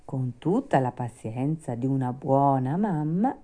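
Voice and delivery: ordinary delivery, nothing marked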